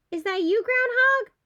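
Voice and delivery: high, unsure little mole voice; squinting Mole. Acted. Mole talking to a tree by mistake, high and unsure. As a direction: peer blindly and ask in a small high voice.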